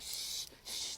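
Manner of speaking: whispered, urgent shushing; mock panicked "shh"; imitated frantic hushing. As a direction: shush like frantic parents on a plane. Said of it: "they're all frantic".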